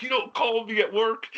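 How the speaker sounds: high-pitched mock scolding; retold tirade, pitched right up; flipping out. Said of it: "flipping out".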